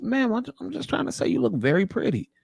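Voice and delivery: a hint of a hurt suitor; meek soft reply. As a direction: timidly explain you meant well. Man answering meekly.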